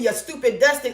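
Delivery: ordinary delivery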